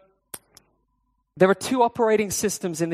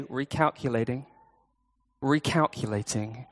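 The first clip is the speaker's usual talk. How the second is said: softer, gentle sat-nav repeat; calm GPS voice; 5 semitones lower, 4 dB quieter than usual. Pretend. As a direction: quietly coax like a patient navigation voice. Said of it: "the Spirit ... wants to point to you and to say".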